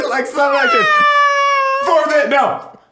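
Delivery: high, loud, fast, laughter-choked talk; can barely talk for laughing; laughing hard. Real, not acted. Laughing imitation of the fall.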